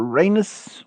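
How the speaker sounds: "Uranus" as RAY-nus; "Uranus" with the first syllable barely there, then RAY-nus; variant pronunciation. Shown as a demonstration. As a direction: say "Uranus" with a long AY. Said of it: "some people call it different how they pronounce it".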